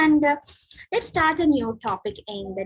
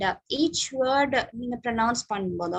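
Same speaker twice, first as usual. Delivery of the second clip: ordinary delivery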